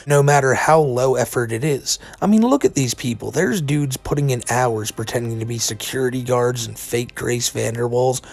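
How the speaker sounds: ordinary delivery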